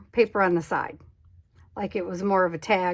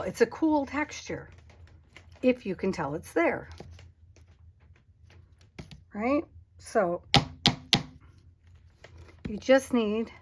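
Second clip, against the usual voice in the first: usual speaking voice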